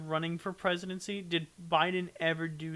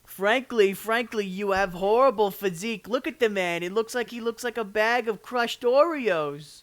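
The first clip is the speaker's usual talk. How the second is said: louder, a bit higher Trump-like insult; mock Trump; 4 semitones higher, 8 dB louder than usual. Mock Trump insulting Putin, louder hammed-up bluster. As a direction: ham up Trump trash talk.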